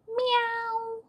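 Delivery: high, sustained cat meow; long, level high-pitched mew. A narrator's reading. Narrator voices the cat's meow.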